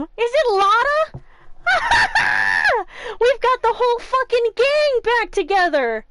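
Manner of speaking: loud, high, laughing shout of delight; excited shout. Real, not acted. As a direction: yell it out, thrilled and laughing. Thrilled at old gang reuniting.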